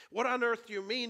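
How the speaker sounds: high, loud, incredulous question; indignant disbelief. A narrator's reading. Shasta challenges the voice over the lions, indignant. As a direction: burst out with a boy's baffled protest.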